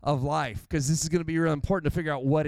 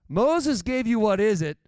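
much louder, higher, driving the line home; louder emphatic repeat; 6 semitones higher, 5 dB louder than usual. Shown as a demonstration. Preacher repeating Jesus to the congregation, loud and emphatic. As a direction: preach it out strong. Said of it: "preacher repeats with emphasis".

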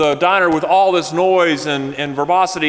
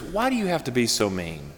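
much softer, lower hurt plea; 4 semitones lower, 9 dB quieter than usual. Retold or imitated. Birthday woman answering her mocking friend, softly hurt. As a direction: let the hurt show quietly.

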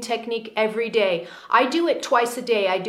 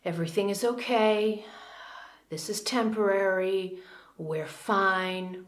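softer, slow and soothing; calm inner talk; 2 semitones lower, 6 dB quieter than usual. Shown as a demonstration. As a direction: settle the panic with gentle reassurance. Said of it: "to calm inner talk".

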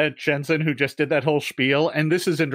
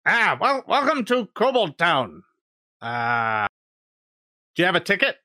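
kobold gatekeeper; 3 semitones higher than usual. Acted. Kobold guard asking the bards for tickets, lightly squeaky. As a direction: ask like a fussy little doorman. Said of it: "the kobolds look at you and go".

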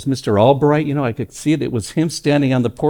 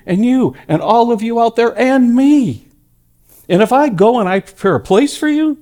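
preacher reading Jesus's promise to the congregation, high and fervent; fervent preaching; 8 semitones higher than usual. Acted. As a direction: build the promise up with fervour. Preaching the promise with rising fervour.

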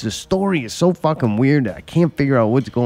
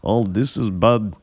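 put-on slow Russian-accented alarm; imitating a Russian accent, slowly; mock Russian accent; slower than usual. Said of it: "that Russian accent".

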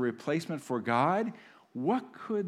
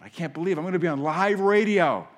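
louder, building thrill at going on air; excited disbelief; 4 semitones higher, 8 dB louder than usual. Retold or imitated. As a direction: blurt it out like a thrilled first-time caller. Caller on hold for radio show.

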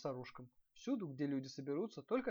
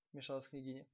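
usual speaking voice

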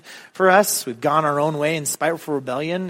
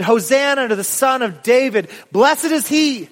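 raised, high proclaiming cry; joyful shout of welcome; crowd shouting; 5 semitones higher, 3 dB louder than usual. Acted. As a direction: call it out like the cheering crowd. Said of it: "those that followed shouted".

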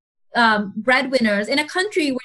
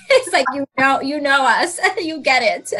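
quoting clients through a laugh. Real, not acted. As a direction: laugh out the words.

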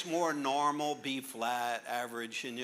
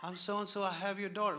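lightly voiced stranger lady; 7 semitones higher than usual. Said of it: "lady repeats".

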